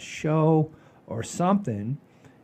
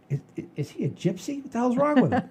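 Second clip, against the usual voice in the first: much higher, incredulous retold question; mock bewildered kid; 11 semitones higher, faster than usual. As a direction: play the baffled kid, voice rising. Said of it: "And I'm like".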